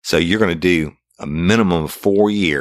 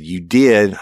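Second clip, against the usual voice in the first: louder, higher flat accusation; angry insistence; 6 semitones higher, 4 dB louder than usual. Retold or imitated. Detective accusing a lying suspect, angry. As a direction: slam the fact down.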